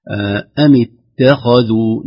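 اتخذوا slow, with a light ت and a heavy خ; correct tajweed. Shown as a demonstration. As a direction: say أم اتخذوا slowly, keeping the ت thin. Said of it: "على سبيل المثال".

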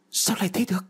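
can't believe what he hears; grieving father cries out in disbelief; shocked disbelief. A narrator's reading. Father hears son drowned.

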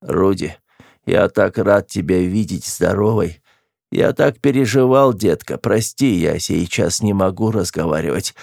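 feigned dying voice, slowing and labouring; умирающий голос. Pretend. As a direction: play at death's door with feeble moans. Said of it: "умирающим голосом произнёс". (притворно умирающий голос, с охами)

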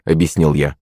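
usual speaking voice

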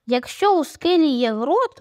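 ordinary delivery, nothing marked

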